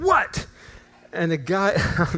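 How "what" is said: high, abrupt snap into the phone; retold angry shout. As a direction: re-enact the furious phone answer. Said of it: "That's what I did. I said".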